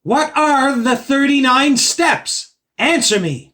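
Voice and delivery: calling to the stage insistently; loud demand. Acted. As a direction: hammer every word of the question. Repeats question, demanding answer.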